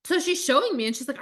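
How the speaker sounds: ordinary delivery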